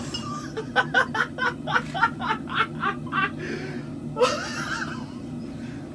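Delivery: long high-pitched cackling laugh; helpless giggling fit, very high. Real, not acted. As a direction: laugh uncontrollably at your own joke. Cracking up at own accent bit.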